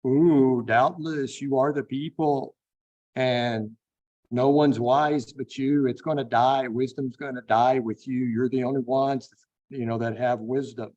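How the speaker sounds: needling, sarcastic tribute to know-it-all friends. Acted. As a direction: lay on the mock admiration. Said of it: "the sarcasm in Job's voice".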